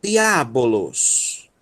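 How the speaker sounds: "Diabolos" drawn out slowly, di-a-bo-los; slow syllable by syllable. Shown as a demonstration. Teacher slowly spelling out "Diabolos" for students. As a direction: stretch out every syllable of "Diabolos". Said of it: "teacher repeats slowly".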